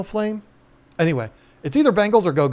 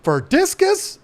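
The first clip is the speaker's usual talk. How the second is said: much higher, squeaky mock disbelief; retold astonishment; 3 dB louder, slower than usual. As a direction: act out being floored by the news. Retold disbelief at tap water for discus.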